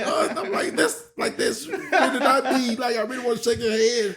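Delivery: put-on high, half-laughing whisper to the kid; apologetic heel aside. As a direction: sneak a sorry to the kid while laughing. Wrestler secretly apologizing to kid.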